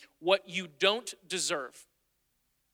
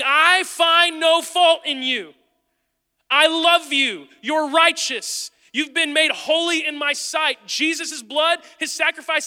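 loud, higher, slow proclaiming voice of God; beyond a whisper; 6 semitones higher, 8 dB louder, slower than usual. Acted. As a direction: call it out like God insisting. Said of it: "he's beyond the whisper".

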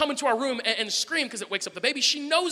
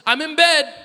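put-on loud, high child calling out; imitating small daughter; 8 semitones higher, 6 dB louder, slower than usual. Daughter fooling parents from behind door, calling out. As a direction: call it through the door like a sly toddler. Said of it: "she goes".

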